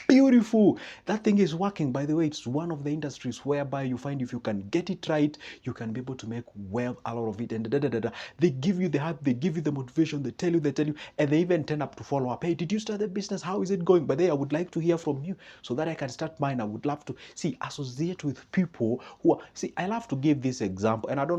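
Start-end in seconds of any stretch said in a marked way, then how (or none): 0.1-1.9 much higher, much louder delight; excited praise. Retold or imitated. Hype friend praises idea. 12.4-17.1 higher, keen follow-up call; lightly voiced supportive friend; eager friend checking in.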